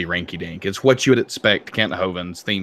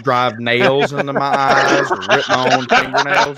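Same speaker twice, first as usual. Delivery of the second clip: laughing; 4 semitones higher, 3 dB louder, slower than usual. Real, not acted. Joking about dreaded visit.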